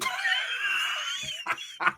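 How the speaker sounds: long wheezing laugh; re-enacted burst of laughter. Bursting out at the youngster's greeting, wheezy imitated laugh. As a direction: imitate a helpless, drawn-out cackle. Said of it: "I burst out laughing".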